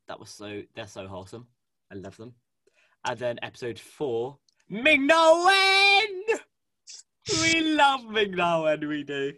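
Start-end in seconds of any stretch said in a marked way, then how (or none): 4.7-9.3 high, loud drawn-out squeal of adoration; excited fan cheer. Real, not acted. Fan gushing about Ming Na Wen, excited cheer. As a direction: shout it long and high with delight.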